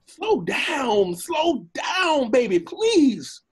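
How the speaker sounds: high, drawn-out plea; panicked pleading. Acted. Donkey to the amorous dragon, pleading in panic. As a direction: beg an over-eager admirer to ease off.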